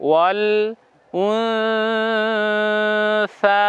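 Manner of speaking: উনসা with the সা held long, nasal before it; drawn-out recitation. Shown as a demonstration. Teacher reciting ওয়াল উনসা with the long pull. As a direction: stretch the সা for a full alif. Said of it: "দেখুন".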